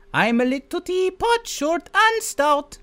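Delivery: very high, slow put-on Mario; silly Mario voice; Mario singsong. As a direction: chirp the nursery line in character. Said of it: "hyphen A's".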